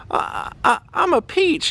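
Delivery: timid player. Retold or imitated. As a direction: stammer it like a nervous young player. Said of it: "She says".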